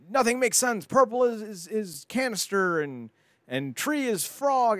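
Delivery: high, slow hammed-up radio proclamation; parodied solemn broadcast of gibberish; mock radio announcer. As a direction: declaim nonsense like an earnest DJ. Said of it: "and he's like".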